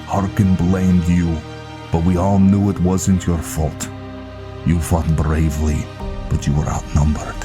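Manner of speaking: Viking recounting a grim past. Acted. Viking reminding Velen of the ambush, solemn storytelling. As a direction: tell the old tale to a comrade, projected.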